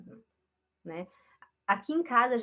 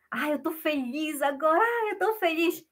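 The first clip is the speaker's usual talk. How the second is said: acting happy; 6 semitones higher, 3 dB louder than usual. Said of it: "Se eu falo assim".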